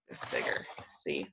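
soft whispered aside; whispering. Real, not acted. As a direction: whisper it while showing. Showing the flap up close.

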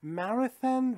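marathon with an American flat a; American pronunciation. Shown as a demonstration. Teacher demonstrating American marathon. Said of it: "انگلیسی امریکایی".

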